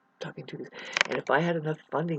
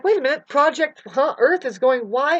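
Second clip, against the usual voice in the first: voice of the alarmed universe; 8 semitones higher, 6 dB louder than usual. Retold or imitated. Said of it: "it's like".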